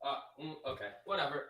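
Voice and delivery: ordinary delivery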